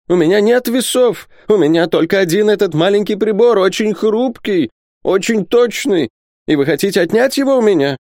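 very high, wailing miser; distressed outcry. Acted. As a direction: protest shrilly as if being robbed. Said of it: "воскликнул Исаак Хакабут". (причитания скряги, очень высоким голосом)

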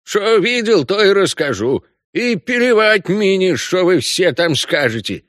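put-on high Odessa accent, defiant; defiant Odessa orc voice; Odessa Russian. A narrator's reading. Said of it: "неповторимым одесским говором". (одесский говор)

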